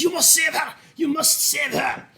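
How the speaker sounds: put-on high, desperate shout with a German accent; Johannes in German accent. Game master voices a man being swallowed up, pleading German-accented shout. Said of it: "that was a German accent".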